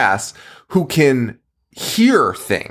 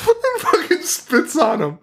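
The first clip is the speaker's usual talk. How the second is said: ordinary talk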